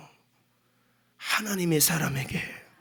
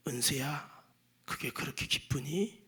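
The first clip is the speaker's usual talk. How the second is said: soft, sorrowful whisper, much lower and softer; sad voice of God; 7 semitones lower, 11 dB quieter than usual. Retold or imitated. God gently rebuking the preacher, in a sad whisper. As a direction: grieve quietly over his small joy. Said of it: "하나님이 슬픈 목소리로".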